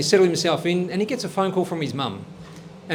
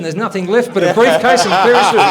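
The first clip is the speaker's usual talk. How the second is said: louder, breaking into laughter at the end; can't hold the laugh in as the joke lands; laughing punchline; 8 dB louder than usual. Acted. Comedian cracking up at his own politician joke, loud laughing delivery.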